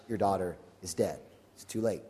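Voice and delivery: low, hushed, somber delivery; grave death news. Acted. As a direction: break bad news softly, voice dropped. Messengers break death news to father.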